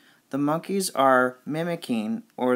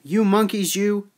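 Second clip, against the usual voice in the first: raised, higher storybook scolding; 6 semitones higher, 5 dB louder than usual. Acted. Peddler speaks to the monkeys, scolding. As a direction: scold them, mildly cross.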